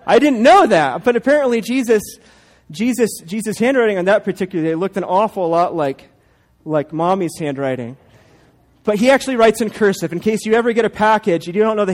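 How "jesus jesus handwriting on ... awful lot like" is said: ordinary talk, nothing marked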